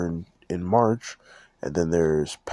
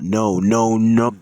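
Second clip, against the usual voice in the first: emphatic no; 4 semitones higher, 9 dB louder, slower than usual. Acted. Sarcastic denial of copying idea.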